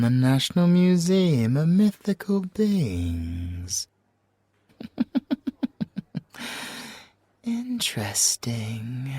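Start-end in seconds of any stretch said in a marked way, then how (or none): 4.8-6.9 airy, high little giggle; breathy high giggle. Acted. Reading the ransom letter to the officer, breathy giggle. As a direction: giggle at the absurd theft. Amused at the stolen crown.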